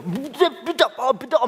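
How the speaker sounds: high-pitched indignant sputter; mock angry squabbling. Sister arguing back at her brother, mock furious sputter. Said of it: "막 화를 내면서".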